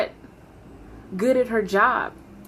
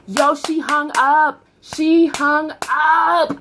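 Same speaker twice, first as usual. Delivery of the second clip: slow, louder, stunned; stunned disbelief; 4 semitones higher, 3 dB louder, much slower than usual. Real, not acted. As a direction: drag out the disbelief. Outrage at being hung on.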